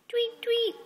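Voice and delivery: high, light tweet tweet in a chirpy bird voice; birdie chirp. Pretend. Mother chirps birdie sounds to her toddler, high.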